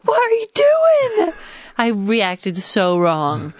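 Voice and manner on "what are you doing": much higher, slow shocked cry; shocked shriek. Retold or imitated. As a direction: shriek it at the child. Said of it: "I was like".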